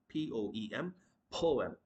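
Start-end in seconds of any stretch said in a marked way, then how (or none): 1.3-1.8 poem as "POH-um"; correct pronunciation. Shown as a demonstration. Teacher repeating after spelling, poem as "POH-um". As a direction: round "oh" in poem. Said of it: "the word is going to be".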